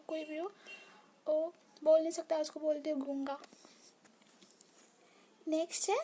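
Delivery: ordinary talk, nothing marked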